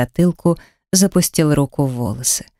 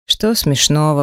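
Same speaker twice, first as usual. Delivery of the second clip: usual speaking voice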